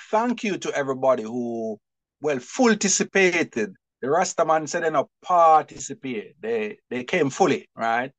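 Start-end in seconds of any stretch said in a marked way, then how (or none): none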